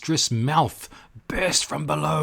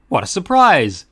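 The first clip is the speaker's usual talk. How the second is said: loud, raised surprise; surprised bottle; 7 semitones higher, 8 dB louder than usual. Acted. Bottle greets the cork with loud surprise. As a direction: exclaim in delight.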